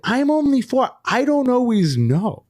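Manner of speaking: put-on high, slow small-child voice; four-year-old daughter. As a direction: imitate a little kid pleading innocence. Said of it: "she's like".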